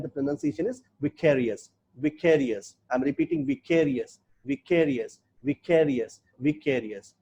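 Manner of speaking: "vicarious" said slowly and clearly as vi-KE-ri-ous, with a falling tune; careful pronunciation. Shown as a demonstration. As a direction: model "vicarious" for learners, vi-KE-ri-ous, clean fall each time. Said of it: "listen the pronunciation". ("vicarious" को vi-KE-ri-ous, धीरे और साफ़ उच्चारण)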